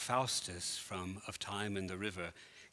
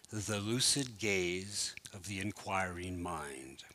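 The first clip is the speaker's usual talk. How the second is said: recited quote; 2 semitones lower than usual. Acted. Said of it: "aus der ich zwischendurch heute Abend kleine Schnipsel einfügen werde, so wie jetzt".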